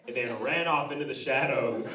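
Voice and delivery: normal talking voice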